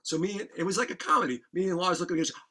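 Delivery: ordinary delivery, nothing marked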